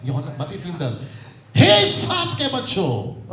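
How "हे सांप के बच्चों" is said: high, loud rebuke with stretched हे; scolding. Shown as a demonstration. Teacher showing the rebuke verse, loud scolding. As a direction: thunder हे long, high and loud. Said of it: "उसमें डांट के पढ़ सकते".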